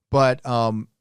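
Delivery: ordinary talk, nothing marked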